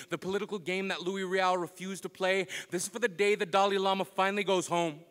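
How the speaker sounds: ordinary talk, nothing marked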